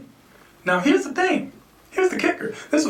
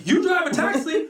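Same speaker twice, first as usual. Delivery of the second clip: high, laughing disbelief; can't believe the four-year-old drives; laughing "you drive a taxi?" raised high; incredulous; 9 semitones higher than usual. Retold or imitated. Incredulous reply to kid.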